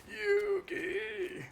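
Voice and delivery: very high, desperate call of the name; Grandpa cry. Retold or imitated. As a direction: Grandpa yelling from the Shadow Realm. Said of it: "Grandpa came back ... take over your voice".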